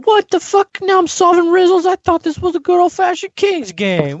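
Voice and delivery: loud, high-pitched outraged disbelief; mock indignation. Player complaining about the game's riddles, high mock outrage. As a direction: squawk it high, as a hammed-up protest.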